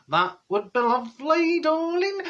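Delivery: high-pitched mock Yorkshire accent; badly faked Yorkshire, rising very high at the end. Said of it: "can't do Yorkshire accent".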